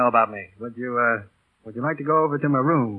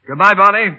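higher and louder, calling up to her from below; called out goodbye; 6 semitones higher, 8 dB louder than usual. Acted. Pilot calling farewell to Bonnie across the field, raised voice. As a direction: call it across the airfield.

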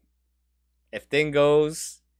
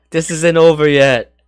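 loud, higher, drawn-out insistence; riding the party high, much louder; festival hype; 4 semitones higher, 11 dB louder, slower than usual. Retold or imitated. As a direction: hype it up like the night continues. Acting out festival high in head.

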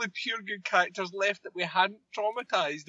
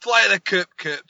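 loud command; 3 semitones higher, 7 dB louder than usual. Retold or imitated.

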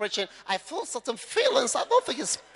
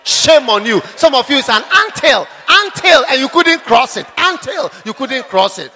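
loud mocking scold from the pulpit; preacher scolding the crowd over an anthill; preaching rebuke; 13 dB louder than usual. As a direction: shame them loudly, half laughing.

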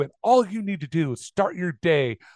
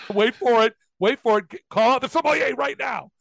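high, building to a hammed-up call; mock excited announcing; 7 semitones higher than usual. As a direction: play up the big reveal, pitch climbing. Mock wine-service scene, building to a call.

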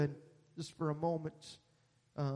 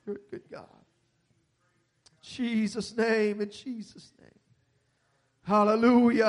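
fervent intercessory prayer, building louder; praying over the sick; 5 semitones higher, 11 dB louder than usual. Real, not acted. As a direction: pray over him with rising fervor. Prays for dying friend Vern.

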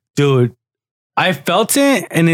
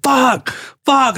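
retold scream; 10 semitones higher than usual. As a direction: imitate a pained yell, pitch thrown up. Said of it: "he was fucking screaming".

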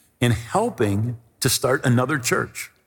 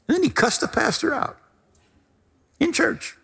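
mock shock; 13 semitones higher than usual. Real, not acted. Shocked telling of cussing.

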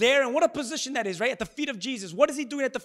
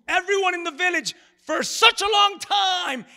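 preaching shout; 9 semitones higher, 7 dB louder than usual. Real, not acted. Preaching emphasis.

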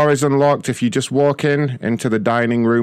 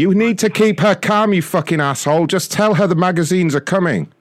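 angry shout; 8 semitones higher than usual. Acted. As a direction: bark the order in a rage. Caller yelling at James.

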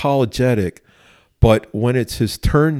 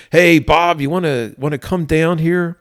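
higher, raised calling-up voice; calling upstairs; 7 semitones higher than usual. Retold or imitated. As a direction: call up to someone upstairs, casually imitated. Sergeant calls upstairs to the man.

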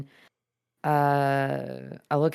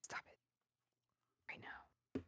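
whispering; 26 dB quieter than usual. Real, not acted. Scolding the cat in whisper.

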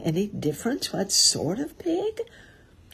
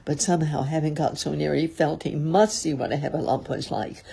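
ordinary delivery, nothing marked

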